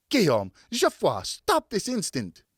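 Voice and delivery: put-on mother yelling at the boys; angry mother's shout. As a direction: stop them cold.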